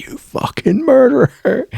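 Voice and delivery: high, mocking accusation in a put-on clown voice; Sweet Tooth snarling. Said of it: "Sweet Tooth's like".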